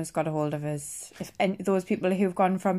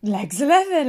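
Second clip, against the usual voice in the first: sing-song bingo number call; bingo caller; 4 semitones higher, 4 dB louder than usual. Retold or imitated. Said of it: "like a bingo caller".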